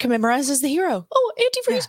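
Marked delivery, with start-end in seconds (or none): 1.1-1.9 much higher mock realization; mock eureka. Kid voiced high, suddenly realizing antifreeze. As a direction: squeak it out like a sudden discovery. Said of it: "and he's like".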